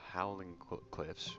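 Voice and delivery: ordinary delivery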